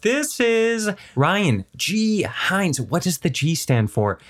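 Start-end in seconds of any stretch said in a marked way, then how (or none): 0.0-2.8 slow, high-pitched showy introduction; grand guest announcement. Acted. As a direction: stretch the name out like an emcee. Host announces the guest formally.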